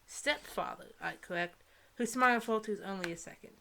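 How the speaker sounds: normal talking voice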